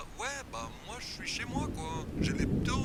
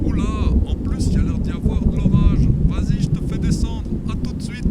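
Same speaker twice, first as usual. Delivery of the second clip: over the phone; 18 dB louder than usual. Acted.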